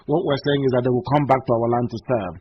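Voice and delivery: ordinary talk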